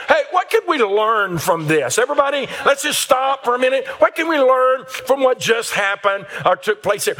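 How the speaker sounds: rally everyone to stop and learn; mock teacher addressing the room. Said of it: "a gift of teaching".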